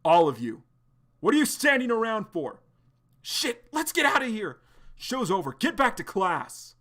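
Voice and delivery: teacher yelling at gawking students; shouted order. Acted. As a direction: break up the crowd. Teacher dispersing crowd.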